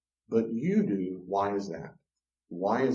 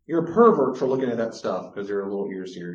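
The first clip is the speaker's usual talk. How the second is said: ordinary delivery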